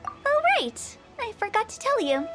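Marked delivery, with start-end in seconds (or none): 0.1-1.0 high, quick remembering; sudden recall. Acted. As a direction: pop up as if just remembered.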